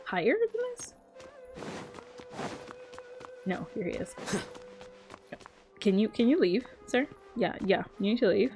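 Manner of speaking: ordinary talk, nothing marked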